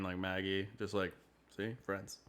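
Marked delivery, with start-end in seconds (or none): 1.6-2.2 soft, low aside; side-eyeing. Acted. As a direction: say it low and quiet, with a side-eye in it. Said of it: "like, side-eyeing".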